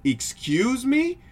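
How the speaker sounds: high, loud, drawn-out disbelief; incredulous. Acted. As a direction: stretch it out slowly, pitch shooting up. Acts shock at buddy's warning.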